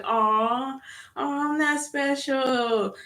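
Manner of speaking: high, slow, babyish mock sympathy; sarcastic high baby voice, slowly; mock pity. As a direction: coo it sarcastically like to a toddler. Mocking the angry lady.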